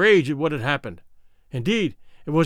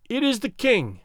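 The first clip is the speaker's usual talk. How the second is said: thin piping voice; 6 semitones higher than usual. Acted. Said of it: "piped out a thin voice".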